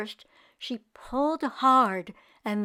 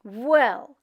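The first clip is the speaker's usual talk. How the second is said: ordinary delivery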